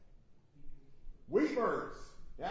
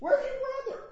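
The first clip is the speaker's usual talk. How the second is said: much higher, louder searching question; God asking Cain; 8 dB louder than usual. Acted. Teacher voicing God asking Cain about his brother, high and loud. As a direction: demand it like God confronting Cain. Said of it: "what did he say to Cain".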